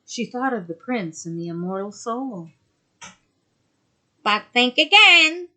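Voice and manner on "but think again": loom over her as the sea witch. Acted. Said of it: "said the witch".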